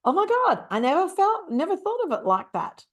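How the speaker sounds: gasp, then high-pitched revelation; sudden aha, voice leaping up; acted-out astonished discovery; amazed realization. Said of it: "then your mind goes".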